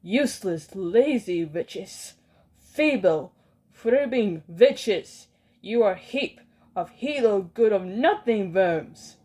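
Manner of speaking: slow, loud scornful tirade; furious insults. Acted. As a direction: drag out each insult with contempt. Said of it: "she yelled".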